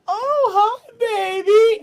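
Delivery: put-on very high cooing baby talk; squeaky loud grandma baby talk. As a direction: coo at the baby like a doting grandma. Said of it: "picks up the baby and goes".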